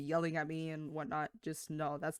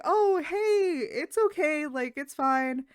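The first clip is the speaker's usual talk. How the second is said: high, louder, cheery customer-service reassurance; smooth it over brightly, pitched up; bright reassuring phone voice; 9 semitones higher, 6 dB louder, slower than usual. Retold or imitated. Retail worker reassuring the apologetic caller, bright and raised.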